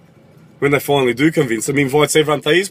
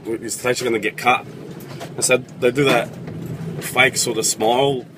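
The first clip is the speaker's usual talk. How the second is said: ordinary delivery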